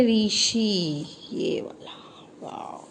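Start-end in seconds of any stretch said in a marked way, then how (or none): 0.0-1.1 ऋषि stretched out very slowly; slow demo word. Shown as a demonstration. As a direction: sound out ऋषि syllable by syllable for a child. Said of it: "इसके बाद अब हम ले लेते हैं री से".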